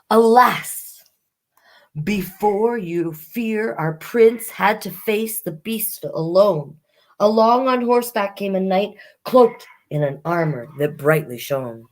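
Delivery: lively rhyming read-aloud; reading a rhyming fairy tale to children; storybook narration. Acted. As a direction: tell the fairy tale to young listeners.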